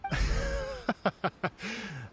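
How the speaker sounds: high, light laugh; long amused chuckle. Acted. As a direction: laugh at the bold claim. Amused by bold claims.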